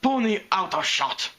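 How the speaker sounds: put-on high, loud accented order; barked. A narrator's reading. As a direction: bark the command in a thick accent. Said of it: "Then she barked".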